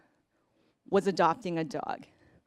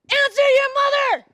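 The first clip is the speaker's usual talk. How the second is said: much higher, much louder bellow; father's angry shout; 10 dB louder than usual. Retold or imitated. Frustrated husband yelling at the son from the driver's seat. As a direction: bark it like a fed-up dad. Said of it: "he just goes".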